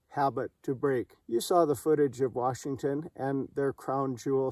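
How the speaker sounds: ordinary talk, nothing marked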